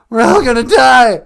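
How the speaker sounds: a scared caller losing his nerve; Cowardly Lion freaking out. Acted. Radio caller panicking about the vaccine, loud and high. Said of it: "he sounds totally different today".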